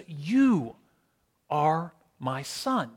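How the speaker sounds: "You" set apart, then a pause; slowly, softly, pausing after "You"; direct, deliberate address. Acted. Voice from heaven talking directly to Jesus, slow and deliberate. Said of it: "talking directly to Jesus".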